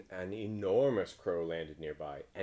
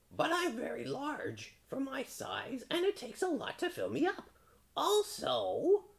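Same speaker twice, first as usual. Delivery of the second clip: very high, drawn-out crow. A narrator's reading. Crow conceding the point, slow high crow voice. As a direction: stretch it out high like a bird.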